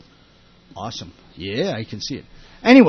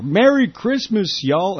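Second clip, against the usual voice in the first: higher, drawn-out mock Texas drawl; put-on Texas accent, pitched up; fake Texas; 8 semitones higher, slower than usual. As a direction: imitate a fake Texan holiday greeting. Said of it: "fake Texas kinda talk".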